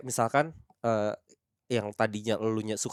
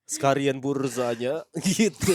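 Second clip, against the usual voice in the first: put-on slow Japanese-accented cashier voice; cheerful Japanese AI cashier; chipper text to speech voice; much slower than usual. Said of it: "A I Jepang gitu".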